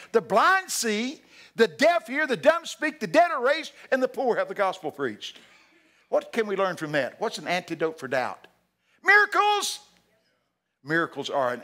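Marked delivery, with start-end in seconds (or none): 0.1-5.4 high, loud list of wonders, each hit hard; triumphant proclamation. Retold or imitated. Jesus answering John's disciples, loud and triumphant. 9.0-9.8 very high, loud preaching shout; exclaimed shout. Real, not acted. Preacher answering his own question on doubt, shouting.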